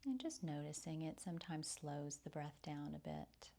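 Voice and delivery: soft, soothing breath-led meditation voice; guided meditation. Real, not acted. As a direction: softly guide them through the breath, with long pauses.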